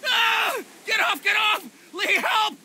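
high, loud panicked screams for help; terrified screaming. Acted. Man under attack screaming to Lee for help, panicked. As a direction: scream like you're being torn apart, slowing at the end.

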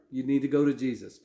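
usual speaking voice

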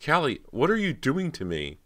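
high, loud pleading cry; terrified plea. Acted. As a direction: beg in terror as darkness falls. Ghost mist swallowing her.

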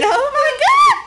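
laughing. Real, not acted. Laughing shout at the scene.

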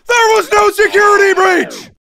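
high, fast punchline yell; emphatic shout. Acted. Comic lands the strict mom joke, high emphatic shout. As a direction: fire it out high and quick.

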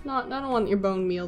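ordinary talk

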